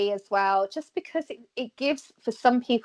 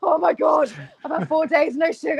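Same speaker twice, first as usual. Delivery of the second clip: panicky client; 7 semitones higher than usual. Retold or imitated. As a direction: ham up the client's sugar-withdrawal panic. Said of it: "He's like".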